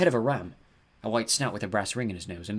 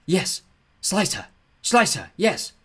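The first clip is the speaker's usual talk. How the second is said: high, excited urging in short bursts; frenzied whispering; 9 semitones higher, 7 dB louder, much slower than usual. A narrator's reading. Glowing orbs urge on the giant, frenzied. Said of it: "swarmed into a frenzy, whispering".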